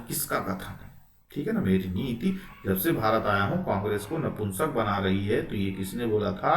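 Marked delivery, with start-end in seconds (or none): none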